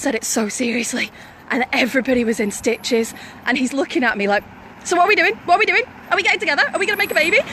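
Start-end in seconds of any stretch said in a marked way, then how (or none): 4.8-7.4 imitated eager questions, pitched high; eager suitor. Man pressing her to get together, eagerly imitated. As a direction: press for an answer like a keen admirer.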